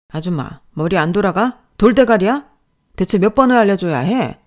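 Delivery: loud insults, rising in pitch; angry scolding. Acted. Boss to older newcomer, angry berating. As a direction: berate as if at wits' end. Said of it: "혼이 나고 있었어요".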